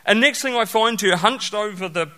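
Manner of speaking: ordinary talk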